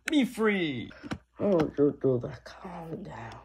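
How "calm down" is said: unvoiced whisper; soft whispered calm down. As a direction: hush them gently. Soothing panicked character.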